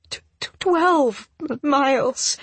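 high, stammering through tears; voice breaking. A narrator's reading. Crying woman overwhelmed by his long walk, voice breaking. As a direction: stumble on each word as the voice cracks. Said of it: "my voice breaking".

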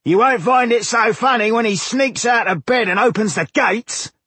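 high, loud angry villager rant. A narrator's reading. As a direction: berate your laughing friends. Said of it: "faced his friends angrily".

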